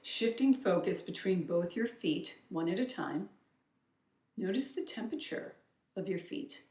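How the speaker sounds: soft, unhurried meditation voice with long pauses; softly led grounding exercise; guided meditation. Acted. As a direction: gently guide the listener's attention inward, leaving space between instructions.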